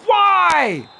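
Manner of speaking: high, loud wailing why; real anguished protest; despairing shout. Real, not acted. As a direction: cry out in disbelief at the screen. Just conceded, wailing at game.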